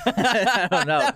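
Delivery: high, slow words through real laughter; laughing. Real, not acted. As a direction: answer while cracking up. Laughing at the Trump remark.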